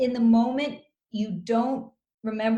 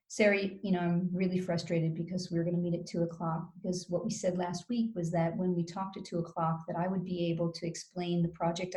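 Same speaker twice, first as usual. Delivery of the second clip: run-on, no pauses, softer; unbroken stream of explanation, low-key; long run-on sentence; 3 semitones lower, 7 dB quieter than usual. Shown as a demonstration. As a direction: keep talking through without a break. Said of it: "So I don't say".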